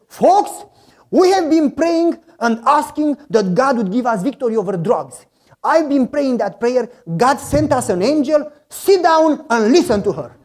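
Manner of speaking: loud, high rallying call to the gang; excited announcement. Retold or imitated. Biker leader announcing to his eleven friends, loud and jubilant. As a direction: proclaim it like big news to the room.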